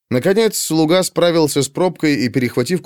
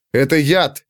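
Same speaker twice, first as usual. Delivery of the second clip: usual speaking voice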